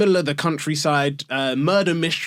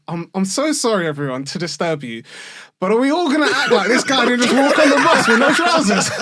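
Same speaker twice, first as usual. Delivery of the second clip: laughing incredulous callout; 8 semitones higher, 5 dB louder than usual. Retold or imitated. Guy on bus calls out passengers.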